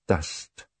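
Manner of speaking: oddly distant lone word. A narrator's reading. Poirot muses on the dust, odd voice. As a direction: repeat it as if lost in thought. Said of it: "said Poirot in an odd voice".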